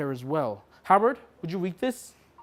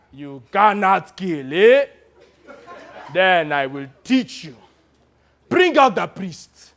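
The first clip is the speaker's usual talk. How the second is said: loud, slow laughing menace; cruel commander; 2 semitones higher, 8 dB louder, slower than usual. Retold or imitated. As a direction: savour the threat. Commander mocking.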